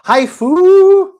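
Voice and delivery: very high, loud, with a long held "fu"; playful cry. Pretend. Host announcing the next game, playful high shout. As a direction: whoop the title out, stretching the last vowel.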